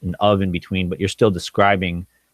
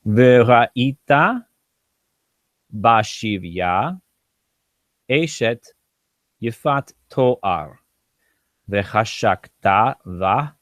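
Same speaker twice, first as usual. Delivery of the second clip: slow verse reading; much slower than usual. Shown as a demonstration. Teacher reads the Hebrew verse aloud.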